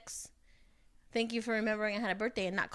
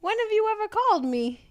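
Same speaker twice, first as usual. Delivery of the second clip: incredulous; 10 semitones higher, 5 dB louder than usual. Retold or imitated. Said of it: "I said".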